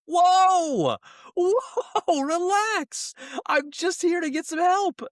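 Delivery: very high-pitched mock alarm; alarmed customer. Startled customer trying to calm an angry DMV clerk, high-pitched alarm. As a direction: squeal it, drawing out the first words in mock shock.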